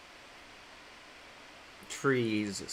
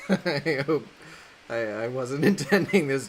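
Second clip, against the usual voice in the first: laughing; 4 semitones higher, 7 dB louder than usual. Real, not acted. Laughing at her own joke.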